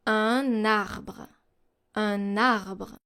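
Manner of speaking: un arbre with the n carried onto arbre; French liaison. Shown as a demonstration. Teacher demonstrating to learners, un arbre linked with n. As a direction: say un arbre as "uh-narbre", n linked forward. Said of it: "For example".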